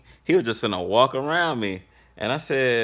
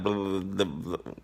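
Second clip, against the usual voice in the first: softer, trailing blah blah mumble; mock mumbling; 6 dB quieter than usual. Recruit mumbling his words in a hurry, trailing off softly. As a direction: imitate a nervous recruit mumbling.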